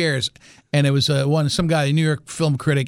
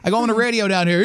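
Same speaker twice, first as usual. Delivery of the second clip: usual speaking voice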